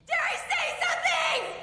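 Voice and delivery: very high shout down a pipe; shrill falsetto shout down the pipe. Acted. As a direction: call down the hole to the brother. Dish shouts down to him.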